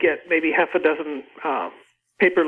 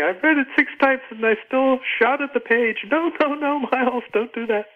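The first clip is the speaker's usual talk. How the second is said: pleading reader quote, pitched higher; mildly acted protest, voice lifted; exasperated reader; 7 semitones higher than usual. Novelist quoting readers pleading with her hero, mildly exasperated. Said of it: "I've had readers tell me".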